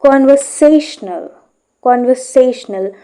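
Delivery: "Conversational" with light stress on "con" and stronger on "sa". Shown as a demonstration. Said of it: "we stress a more on say".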